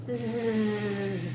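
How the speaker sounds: quiet snoring zzz; soft snore. Acted. Sleeping bee snoring softly for the kids. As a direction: doze off.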